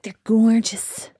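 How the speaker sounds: slow, drawn-out schoolgirl swoon; teenage self swooning. Retold or imitated. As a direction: drag it out dreamily, mocking your younger self. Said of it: "Did you talk like that?".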